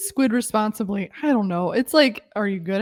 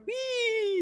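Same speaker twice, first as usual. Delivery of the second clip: put-on high giggly little girl squeal; preteen girl; 12 semitones higher, 6 dB quieter than usual. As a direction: squeal like a giddy schoolgirl. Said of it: "laughing like a little schoolgirl".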